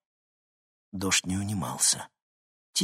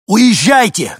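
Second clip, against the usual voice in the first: very loud, high desperate cry; desperate shout; 13 semitones higher, 15 dB louder, slower than usual. A narrator's reading. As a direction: yell it in sheer desperation. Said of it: "крикнул он таким отчаянным голосом". (отчаянный крик)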